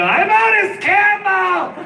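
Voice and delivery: high, drawn-out holler; slow high-pitched comic declaration; in-character proclamation. Retold or imitated. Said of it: "I'm still in character".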